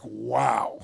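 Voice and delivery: low, soft wow; hushed awe. Retold or imitated. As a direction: let it drop, quietly impressed. Awed reaction to cool answer.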